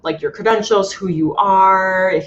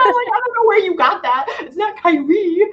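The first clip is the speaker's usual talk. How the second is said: mock correcting; 11 semitones higher than usual. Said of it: "And I'm like".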